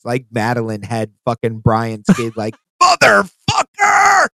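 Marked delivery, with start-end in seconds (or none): 2.8-4.3 drawn-out high shout; hammed-up curse, stretched and high; mock raging yell. Acting out a neck-grab outburst.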